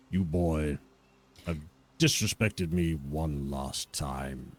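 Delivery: low, cold threat from the lord; lightly voiced noble warning with quiet menace; menacing lord.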